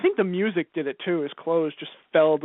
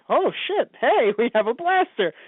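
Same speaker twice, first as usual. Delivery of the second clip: higher-pitched delighted discovery; retold excited reaction, pitched up; mock excitement; 5 semitones higher than usual. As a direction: act out a thrilled surprise. Acts out excited reaction to blaster.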